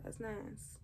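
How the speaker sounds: soft, hushed approval, fading out; sultry murmur. Acted. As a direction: purr it quietly to him. Seductive praise to partner.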